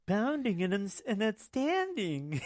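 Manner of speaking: a hint of Clyde calling the game; commentator impression. Sportscaster imitated for a friend, slow and lilting.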